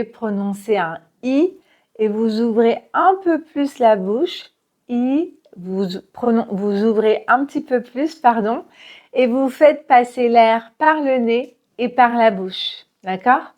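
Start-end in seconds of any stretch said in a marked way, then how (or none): none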